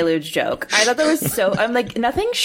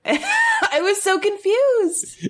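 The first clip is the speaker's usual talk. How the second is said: laughing; 11 semitones higher, much slower than usual. Real, not acted. Laughing at a confusing accent.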